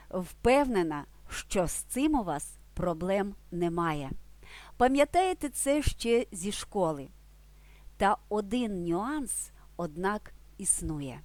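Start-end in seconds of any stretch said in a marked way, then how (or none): none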